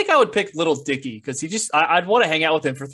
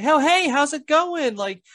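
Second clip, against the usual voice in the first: put-on eager girl greeting; 10 semitones higher than usual. Girl greets him at bar.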